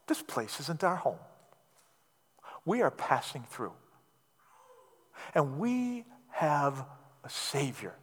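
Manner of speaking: quiet heartfelt sermon close; gentle soft preaching. Acted. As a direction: draw the congregation in with tender conviction. Soft intimate closing of sermon.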